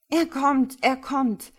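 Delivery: loud, high alarmed cry; agitated. A narrator's reading. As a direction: call out the warning excitedly. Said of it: "Bobby brummte aufgeregt". (aufgeregt, laut und hoch rufend)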